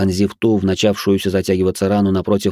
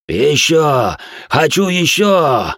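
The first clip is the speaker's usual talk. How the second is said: choking rasp; 7 semitones higher, 3 dB louder, much slower than usual. Acted. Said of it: "Захлёбываясь бледно-алой жижей, прохрипел сектант".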